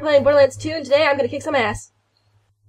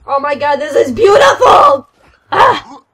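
excited shout; 3 semitones higher, 11 dB louder, slower than usual. Real, not acted. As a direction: shout it out in amazement. Exclaims in delight at game.

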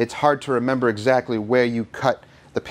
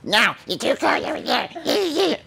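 very high, drawn-out mock voice; exaggerated high-pitched teasing; mocking imitation. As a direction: mimic someone's complaint in a squeaky slow whine. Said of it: "by your stinky face that you're like".

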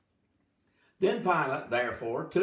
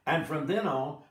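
usual speaking voice

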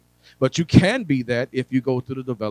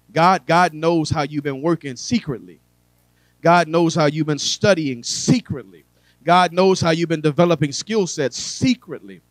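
loud, high preaching build on God knows; preaching at full force; 4 semitones higher, 6 dB louder than usual. Real, not acted. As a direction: preach it, louder each line. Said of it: "preaching build".

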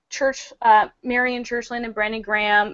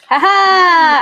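loud, high, gleeful cry of triumph; triumphant ha-ha; 8 semitones higher, 7 dB louder than usual. Real, not acted. Host greeting the lost guest's return, triumphant ha-ha. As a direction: crow it out in delight.

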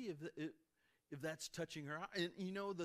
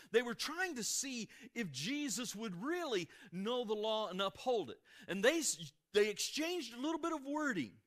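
louder, higher, driving sermon voice; preaching at full force; 6 semitones higher, 5 dB louder than usual. Real, not acted. Pastor pressing his congregation on the Pharisees' trick, preaching loud. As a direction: build it up and hammer the point home.

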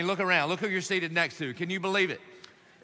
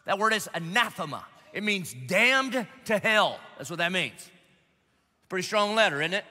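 ordinary talk

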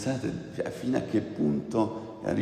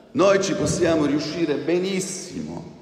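full of hope and strength; 7 semitones higher than usual. Acted. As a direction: proclaim it with Caleb's faith. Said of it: "pieno di forza e fiducia". (pieno di speranza e fiducia, più alto e più forte)

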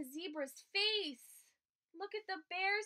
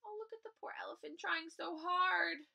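whisper; 2 semitones higher than usual. Real, not acted. Teacher whispering to the children about the poor elephant. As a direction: lean in and whisper to the class. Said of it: "aside to children".

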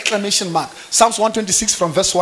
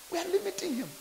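hushed; 10 semitones higher, 14 dB quieter, faster than usual. Acted. Sermon, dropped low.

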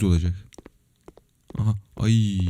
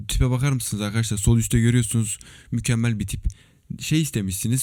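usual speaking voice

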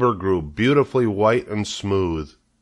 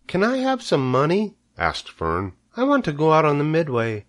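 put-on much higher child's voice, asking; pleading girl; 8 semitones higher than usual. Fern asking her mother for money, high and pleading. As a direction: beg like a little girl.

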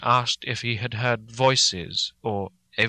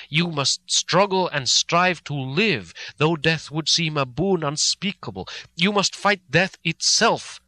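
higher, louder and slow, building; fervent charge; 6 semitones higher, 5 dB louder, slower than usual. A narrator's reading. Van Helsing fervently urging Mina to fight death. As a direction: command her fiercely to fight. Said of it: "said solemnly".

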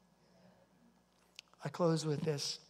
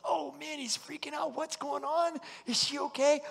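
much higher, anxious fretting; worried; 10 semitones higher, 5 dB louder than usual. Retold or imitated. Jerry fretting over the long phone call, high and anxious. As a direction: panic about what's going on. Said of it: "Jerry's like".

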